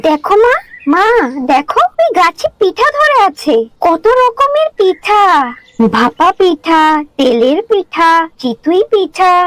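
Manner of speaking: put-on high, excited girl showing off a wonder; amazed child. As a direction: list the treats in high-pitched delight. (অবাক, উচ্ছ্বসিত বাচ্চা মেয়ের গলা)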